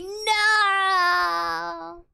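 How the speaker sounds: long, drawn-out wail of mock dismay; mock anguished cry. Streamer reacting to bad news from chat, mock anguished cry. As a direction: cry out loudly, stretching the vowel.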